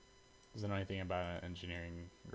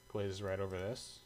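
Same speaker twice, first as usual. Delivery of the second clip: usual speaking voice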